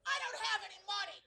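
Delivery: high-pitched defensive yell; sudden yelled brush-off. Retold or imitated. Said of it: "look up and yell".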